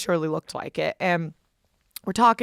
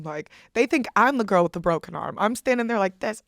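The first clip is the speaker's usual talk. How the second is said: normal talking voice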